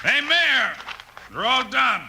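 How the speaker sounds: high, loud call across the street. Acted. Bill calling to the mayor after the fight, loud triumphant call.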